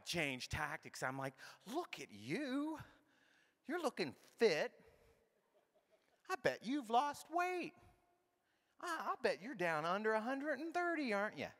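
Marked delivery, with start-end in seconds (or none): 1.7-11.5 high, gushing, put-on compliments; butter her up with a high, coaxing lilt; mock flattery. Changing tactics to learn her weight, mock flattery.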